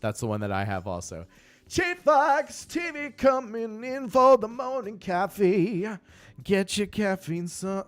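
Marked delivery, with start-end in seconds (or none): 1.7-7.8 high, slow booming welcome shout; announcer voice. Pretend. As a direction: call it out like a radio host. Greeting a viewer as hype announcer.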